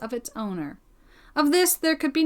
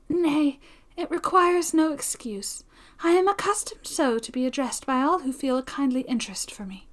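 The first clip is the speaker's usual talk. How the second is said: put-on gracious young heroine; 4 semitones higher than usual. Young woman lets friend use her first name, kindly, higher. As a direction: lift the voice, kindly and sincere.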